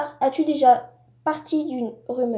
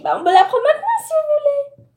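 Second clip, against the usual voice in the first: much higher, put-on voice for the doctor; imitating the doctor; 12 semitones higher, faster than usual. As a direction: mimic the doctor's offer in a high voice. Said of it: "il m'a dit". (imitation aiguë du médecin)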